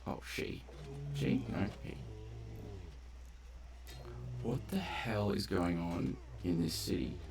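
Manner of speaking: ordinary talk, nothing marked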